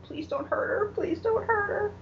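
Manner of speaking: high-pitched mock crying plea; imitated tearful plea. Retelling Mara begging for Jane's safety, mock tearful. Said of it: "really crying".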